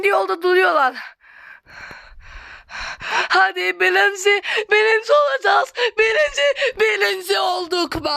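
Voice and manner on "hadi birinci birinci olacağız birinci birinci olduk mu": rising hope near the finish; excited cheering. Real, not acted. Racing to first place.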